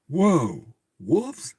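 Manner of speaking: high, thrilled whoa; light up at the word wolves; excited. Real, not acted. Reader delighted the wolves appear, excited.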